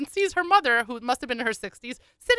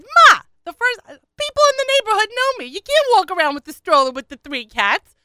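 high, loud mock protest; scolding daughter; 11 semitones higher, 9 dB louder than usual. Daughter objecting to mother's cat stroller, loud scolding. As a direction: holler at Ma in mortified protest.

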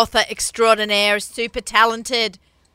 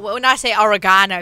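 normal talking voice

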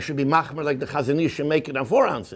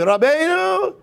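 emotional plea; 10 semitones higher, 4 dB louder, slower than usual. Retold or imitated. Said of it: "he says".

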